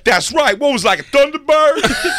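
high, loud mock reminiscing; Tracy impression. Comedian doing Tracy's made-up memory, high and loud.

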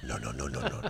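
very soft, low murmur; hushed pretend-killer reassurance; mock soothing. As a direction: quietly hush a victim, low. Acts soothing a stabbed victim.

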